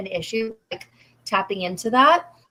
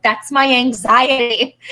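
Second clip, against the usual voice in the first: loud, drawn-out mock retort; mock protest; 3 semitones higher, 8 dB louder, slower than usual. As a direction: push back loudly and slowly, playing it up. Mock retort to her mother.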